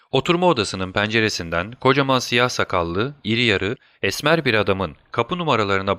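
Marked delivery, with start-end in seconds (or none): none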